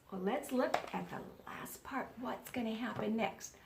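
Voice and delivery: hushed teacher whisper. Real, not acted. Teacher to pupils about the next part, whispering. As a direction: draw them in with a whisper.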